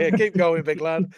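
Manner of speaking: put-on broad Sheffield accent, cheering on. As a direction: gee him on like a Sheffield coach. Mimicking the nutritionist's voice note.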